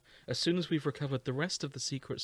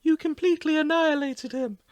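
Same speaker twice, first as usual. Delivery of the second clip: high voice; 13 semitones higher, 5 dB louder than usual. Acted. Voicing Mipha character.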